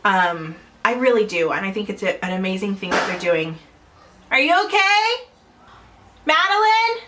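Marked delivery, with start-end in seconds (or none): 4.3-7.0 real high, questioning call; calling out concerned. Real, not acted. Calls out to child mid-video.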